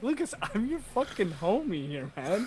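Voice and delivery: mock indignant. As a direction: act hurt that your buddy ignored you. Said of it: "And I was like".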